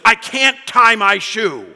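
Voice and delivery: put-on loud, high, slow childish wail; mock an exasperated toddler in tears; frustrated little girl. Dad imitates his crying three-year-old over her shoe, frustrated wail. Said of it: "She's frustrated".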